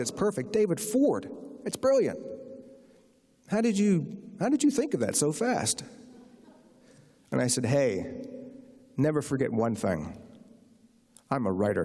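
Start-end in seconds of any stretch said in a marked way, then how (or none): 0.0-5.8 high-pitched, gushing imitation of amazement; hammed-up, high-pitched astonishment; excited agent. Agent relays delighted Italian reaction. 7.7-11.8 lower, self-satisfied reply; understated, mock-important brag; mock-cool boast. Mock-cool boast answering the agent.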